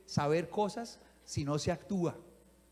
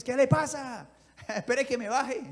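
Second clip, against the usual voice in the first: high, loud playful threat; hammed-up brawler, half laughing; mock picking a fight; 5 semitones higher, 6 dB louder than usual. Jesus imagined threatening the mockers from the cross, playful mock anger. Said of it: "Hubiera empezado el Señor Jesús".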